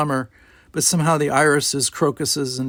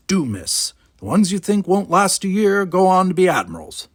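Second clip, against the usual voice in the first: louder, higher, insistent lecture; gruff old gardener lecturing; 7 semitones higher, 4 dB louder than usual. Acted. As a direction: set the cadet straight.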